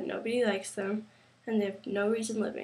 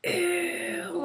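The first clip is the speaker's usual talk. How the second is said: high, loud mocking "ew"; mock disgust, much higher; disgusted bully; 8 semitones higher, 6 dB louder than usual. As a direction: sneer like a mean kid. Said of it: "they'll be like".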